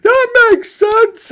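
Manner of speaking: very high, loud, drawn-out moron voice; mocking moron voice. Imitating the morons in the room who all agree, mocking squeal. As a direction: squeal it slowly like a clueless idiot. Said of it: "a bunch of morons in the room".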